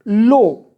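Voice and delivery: "लो" as one loud single puff; one-syllable demo. Shown as a demonstration. Teacher showing students a single syllable, "लो" in one puff. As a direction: say "लो" in one breath, one vowel sound. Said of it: "एक ही puff में बोला जा रहा है लो".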